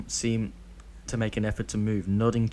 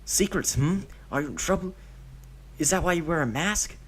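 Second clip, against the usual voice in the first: put-on high boyish questions; a nosy kid prying; curious child; 8 semitones higher than usual. Deidara quizzing Obito about his mask, high curious child voice.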